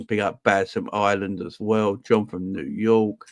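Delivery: ordinary talk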